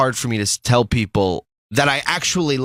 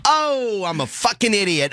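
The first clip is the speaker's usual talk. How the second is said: self-mocking realization; 9 semitones higher than usual. Retelling self-reproach at realization.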